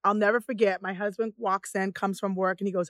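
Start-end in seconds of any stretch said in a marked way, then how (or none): none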